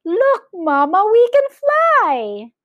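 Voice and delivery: very high, loud, drawn-out glee; chirruping boy. A narrator's reading. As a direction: call to mother high, stretching the last words. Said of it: "chirruped Philip".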